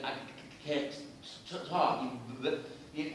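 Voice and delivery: stammer, catching on first consonants; imitated stutter. Demonstrating a young actor's speech, halting stutter. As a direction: get stuck and repeat the opening sound. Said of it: "he had a stutter".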